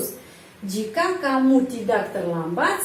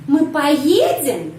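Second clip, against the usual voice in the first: поедем drawn out, lifted, then falling; stress on main verb; 4 dB louder, much slower than usual. Shown as a demonstration. Teacher showing where question intonation falls, on поедем. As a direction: ask slowly by stressing поедем. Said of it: "di sini penekanan intonasi pada kerja semantik".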